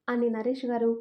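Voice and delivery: plain reading voice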